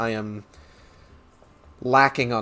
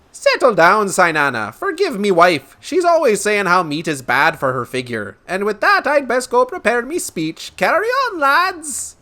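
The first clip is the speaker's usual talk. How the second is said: high, loud put-on brogue; blustering merchant; 9 semitones higher, 6 dB louder than usual. Merchant hushing his wife before leaving, blustering brogue. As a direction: bluster heartily in a lilting brogue, rising to a call.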